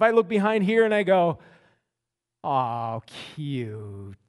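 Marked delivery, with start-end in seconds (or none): 2.4-4.2 low, soft, cooing "oh, cute"; tender aww. Shown as a demonstration. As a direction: melt at something adorable. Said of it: "might you think it's my wife".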